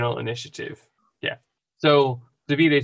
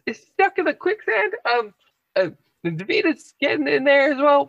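high, loud cry for help; frantic, high-pitched yelling through a window; urgent shout; 3 dB louder than usual. Acted. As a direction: call out in alarm, pitched way up. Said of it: "and shout".

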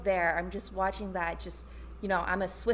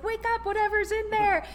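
a higher mock call to wake something up; a light, higher imitation of the frantic husband; imitating his urgent shouting; 10 semitones higher than usual. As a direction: playfully re-enact him yelling at the crate. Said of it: "and he's like".

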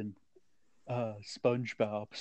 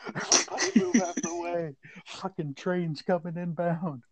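mock warning call; 5 semitones higher, 4 dB louder than usual. Mock warning of charging woman.

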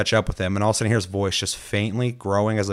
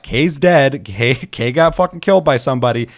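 tag; 5 semitones higher, 7 dB louder than usual. Retold or imitated. Retold alarmed news to the group.

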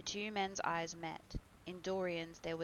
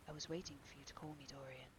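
tense whisper of a dreadful secret; very quiet; 3 semitones lower, 10 dB quieter, faster than usual. A narrator's reading. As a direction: whisper it so no one else hears. Dorian's whispered reply.

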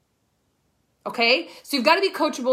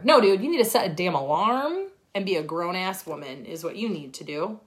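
normal talking voice

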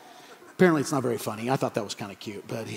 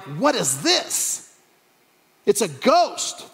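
hammed-up fright, drawn out slowly; crying out in fear; 4 semitones higher, much slower than usual. Disciples seeing Jesus walk on the lake, mock fearful cry. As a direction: stretch each word in mock terror. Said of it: "they cried out in fear".

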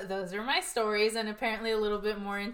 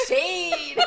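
laughing exclaim; 9 semitones higher, 7 dB louder than usual. Retold or imitated. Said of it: "And I'm like".

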